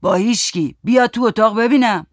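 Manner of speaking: moderate shout, rising as it goes; shouted order. A narrator's reading. Grandpa shouting workmen into the room, commanding. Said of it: "باباجی داد زد".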